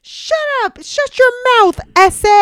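high, loud mock yell; hammed-up high-pitched holler; yelling kid. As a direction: imitate a kid shouting abuse across a park. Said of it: "yells out to them".

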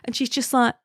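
ordinary talk, nothing marked

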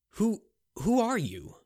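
high, slow, halting question; stammering fear. Acted. Bound Picard asks his captor, frightened. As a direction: stumble on the words in fear.

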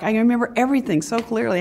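ordinary talk

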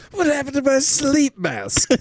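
high, put-on comic whine; a lightly voiced odd character.

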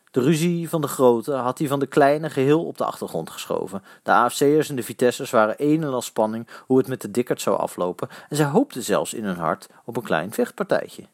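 plain reading voice

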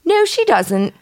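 squeaky put-on kid voice with a phony French lilt; kid's silly fake French voice. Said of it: "that stupid voice the kids do".

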